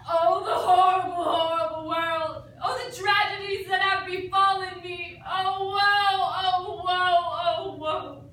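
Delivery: loud, wailing tragic cry of woe; harrowing lament. Acted. Actress opens the duet scene with a loud tragic wail. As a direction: ham up the tragedy, loud and slow. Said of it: "the harrowing expression as she sets the scene".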